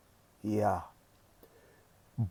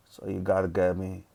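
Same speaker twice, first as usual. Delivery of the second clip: usual speaking voice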